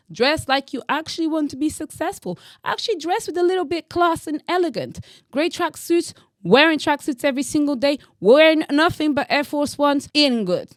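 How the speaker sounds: high, loud exasperated rant about tracksuits; heated rant. Real, not acted. Vlogger scolding British men for wearing tracksuits, loud and high.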